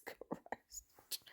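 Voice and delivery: slow stunned whisper. Real, not acted. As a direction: breathe it out in disbelief. Shocked at the price.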